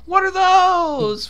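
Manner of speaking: high, loud, drawn-out mock shriek; movie scream. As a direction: screech the meme line, stretching every word. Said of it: "and she screams".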